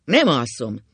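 loud, stubborn refusal; cantankerous. A narrator's reading. Ákos refuses his wife's order to sleep, cantankerous. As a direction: refuse flatly and forcefully. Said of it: "mondta Ákos hepciásan".

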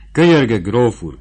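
loud, pleading plea to the count; pleading cry. A narrator's reading. Rassi cries out, begging the count to accept. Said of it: "kiáltotta".

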